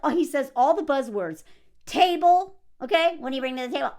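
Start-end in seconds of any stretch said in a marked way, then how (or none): none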